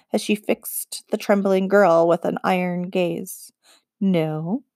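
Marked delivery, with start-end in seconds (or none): 4.0-4.6 chill them with one word; cold menace. A narrator's reading. Witch's iron gaze.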